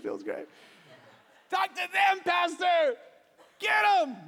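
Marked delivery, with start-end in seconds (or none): 1.5-4.2 high, loud hollering from the pews; egging him on. Acted. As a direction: cheer the pastor on against everyone else. Acts congregant egging on the pastor.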